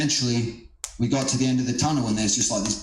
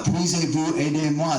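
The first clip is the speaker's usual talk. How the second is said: French; 5 semitones higher than usual. Retold or imitated. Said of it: "I'm like".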